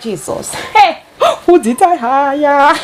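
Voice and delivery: high, slow amazed exclamation; playful astonished cry; mock disbelief. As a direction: act stunned at your own luck. Shocked at nanny working already.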